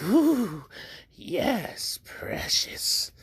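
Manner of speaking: high, breathy leering voice sliding into a whisper; high creepy purr that drops to a whisper; creepy pervert voice. Acted. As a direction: leer over the words like a creepy little pervert. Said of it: "I'm gonna just make him a little bit perverty".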